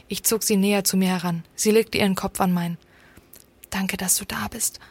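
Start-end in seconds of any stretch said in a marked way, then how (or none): 3.7-4.8 soft, unvoiced whisper. A narrator's reading. Pale thanks him for being there, whispering. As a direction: whisper it close and quietly. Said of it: "flüsterte sie".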